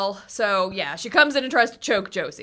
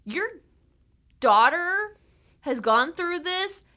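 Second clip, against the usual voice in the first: higher, slow, pointed lecture; scolding absent parents; 9 semitones higher, slower than usual. Retold or imitated. Talking to absent parents, high pointed scolding. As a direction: lay down the law to the parents. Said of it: "but I'm like".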